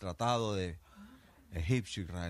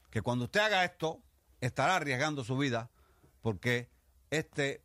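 solemn officer's order; 6 semitones higher, 4 dB louder than usual. Acted. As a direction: proclaim it like a general at a parade.

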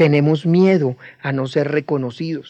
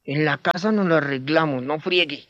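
tight-voiced warning; 2 semitones lower, 7 dB quieter than usual. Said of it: "apretamos la voz y le decimos".

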